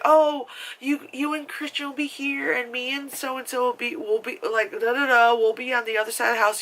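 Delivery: put-on high, sing-song girly voice; mock sister voice. Sister offering her brother the house, high and sing-song. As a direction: ham up the sister's suggestive invitation. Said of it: "and she's like".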